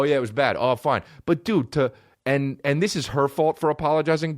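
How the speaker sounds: ordinary delivery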